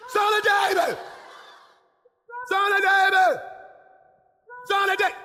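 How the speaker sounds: high, loud cry across a crowd; shouted plea. Acted. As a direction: holler it desperately to be heard. Acts out blind man's cry.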